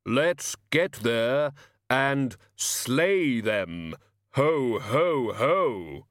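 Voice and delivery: very slow, solemn pronouncement and forced laugh; menacing Death. Acted. Death setting off to slay impostors, menacing. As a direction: draw out every word with grim weight.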